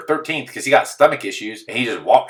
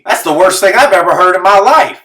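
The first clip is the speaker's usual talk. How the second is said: louder and higher, appalled; incredulous outburst; 9 semitones higher, 8 dB louder than usual. Real, not acted. Reacting to a friend's race story with loud disbelief. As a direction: blurt it out in disbelief.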